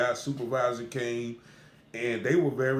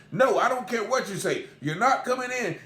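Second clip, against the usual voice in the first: angry bailiff; 8 semitones higher than usual. Retold or imitated. Bailiff refusing entry, quarrel.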